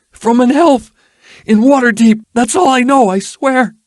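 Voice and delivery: high, loud frightened cry; terrified yelp. Acted. Ruffian cries out to Erilyn in fear, high and loud. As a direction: yelp the denial in panic.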